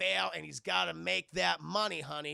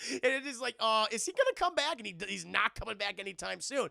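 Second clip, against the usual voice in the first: ordinary talk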